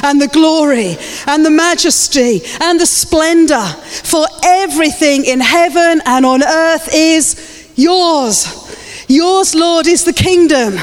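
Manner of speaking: slow, high, ringing proclamation; exultant, stretched-out praise; scripture declaration. Real, not acted. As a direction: declare it to God with drawn-out words. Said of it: "Come on, this is a declaration".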